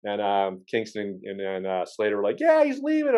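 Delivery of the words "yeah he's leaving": much higher, sing-song mock of the friends. Friends smugly say the guy is leaving, in a mocking high voice. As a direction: tease it like a smug kid.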